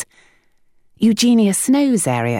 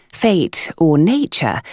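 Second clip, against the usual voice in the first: ordinary delivery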